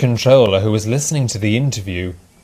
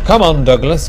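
brisk, raised call; 5 semitones higher, 5 dB louder than usual. A narrator's reading. Driver calls to his nervous engine, calling out. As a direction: call out to the engine, louder and higher. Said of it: "called his driver".

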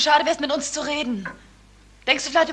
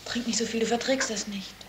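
hushed warning; 3 semitones lower, 10 dB quieter than usual. Acted. Leise Ermahnung am Tisch.

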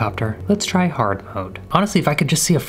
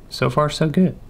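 ordinary talk, nothing marked

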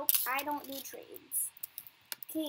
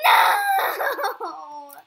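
loud wailing no; crying shout; 5 semitones higher, 14 dB louder than usual. Real, not acted. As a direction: wail it as you lose. Caught in game.

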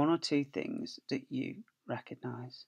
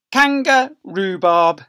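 storybook read; 5 semitones higher, 14 dB louder, much slower than usual. Acted. Reading the label aloud.

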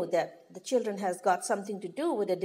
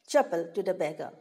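usual speaking voice

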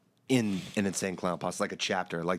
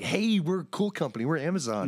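high, smug company pitch; mock corporate voice; 8 semitones higher than usual. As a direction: play a cheesy tech company.

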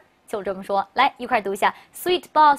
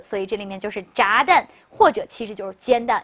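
ordinary delivery

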